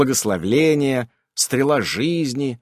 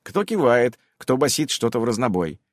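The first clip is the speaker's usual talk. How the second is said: plain reading voice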